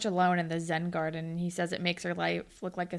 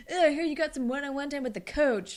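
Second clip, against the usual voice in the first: imitating envious gushing, higher-pitched; mock jealous groan, pitched up; 5 semitones higher than usual. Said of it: "jealous about it".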